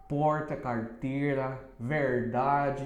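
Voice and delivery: Porta, carteira, verdade with a heavy curled R, slowed; slow São Paulo country R; strong caipira R. Shown as a demonstration. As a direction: pull the R like rural Brazil. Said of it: "soa bem forte".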